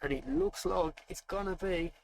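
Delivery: normal talking voice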